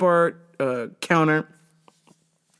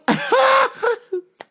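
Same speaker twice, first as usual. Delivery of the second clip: high cackle. Real, not acted. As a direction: crack up at yourself. Laughing at own joke.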